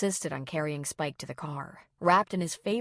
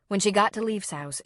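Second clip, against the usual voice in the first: ordinary delivery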